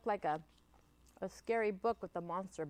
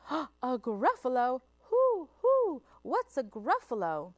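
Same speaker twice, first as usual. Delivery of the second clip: high hooting owl, louder; startled owl; 6 semitones higher, 8 dB louder than usual. Acted. The owl asking the mouse, high and hooting. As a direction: hoot the question, startled. Owl asks about gruffalo.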